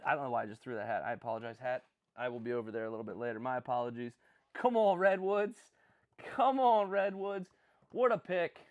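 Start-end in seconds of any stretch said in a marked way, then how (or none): 4.5-8.6 louder, higher, drawn out; rally the team; excited cheer. Real, not acted. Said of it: "Sorry, I got excited".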